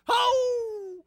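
high, loud yelp of alarm; child recoiling from the splinter; startled cry. Retold or imitated. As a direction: flinch away with a cry. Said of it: "Hadley goes like this".

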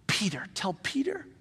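a soft breathy aside; whisper. Acted. Preacher whispering Jesus' wish to the congregation. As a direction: lean in and whisper the name.

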